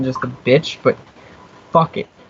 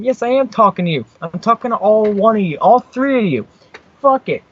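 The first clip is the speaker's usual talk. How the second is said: high-pitched, riled comeback; worked up, climbing higher in pitch; defiant retort; 7 semitones higher than usual. Real, not acted. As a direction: answer back to the whole crew. Snapping back at threatening producer.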